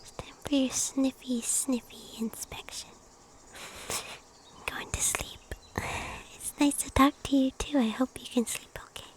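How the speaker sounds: soft unvoiced whisper close to the mic; gentle breathy whisper to viewers; ASMR whisper. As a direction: whisper it like a bedtime ritual.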